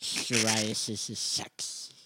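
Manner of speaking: slow, quiet nerdy lisp; Family Guy nerd. Retold or imitated. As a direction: drag it out like the nerd kid. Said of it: "Family Guy gag".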